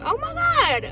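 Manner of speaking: mock gasp. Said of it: "I'm like".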